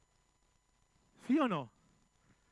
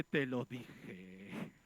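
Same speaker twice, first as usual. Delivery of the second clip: smug I-told-you-so; 9 semitones lower, 6 dB quieter, much slower than usual. Acted. Pastor mocks an "I told you so.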